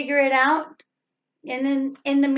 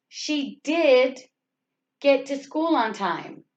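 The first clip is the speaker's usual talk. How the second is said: emphatic did, held apart from what follows; stress on did. Shown as a demonstration. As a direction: hit did hard and break before the rest. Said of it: "what needs to be stressed".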